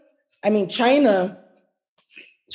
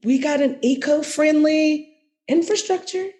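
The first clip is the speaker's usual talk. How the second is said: higher-pitched, lightly acted sales offer; mock bribe pitch; 6 semitones higher than usual. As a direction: imitate someone pitching a bribe, voice lifted. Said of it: "that's pretty much the bribe where it's like".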